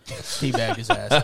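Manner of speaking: laughing. Real, not acted. Laughing at punchline.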